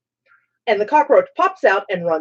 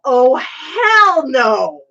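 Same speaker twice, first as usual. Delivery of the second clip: drawn-out mock yell, higher pitch; retold yell; 5 semitones higher, 4 dB louder, much slower than usual. Retelling how dad yelled from the bathroom, mock yell. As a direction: stretch it out like an outraged dad. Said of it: "I hear my dad yell".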